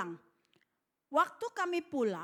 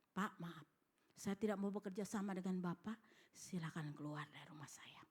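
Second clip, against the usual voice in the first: low, hushed dismissal, trailing into near-whisper; retold polite refusal; 7 semitones lower, 10 dB quieter than usual. Husband sending visitors out of his house, quiet low refusal. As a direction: play the husband quietly, pitched well down. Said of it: "Akhirnya dia ngomong begini".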